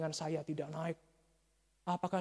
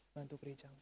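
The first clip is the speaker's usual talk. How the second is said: hushed, softer repeat; soft appeal; 2 semitones lower, 11 dB quieter, faster than usual. Real, not acted. Preacher urging congregation to help their church, soft appeal. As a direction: let it drop into a quiet plea.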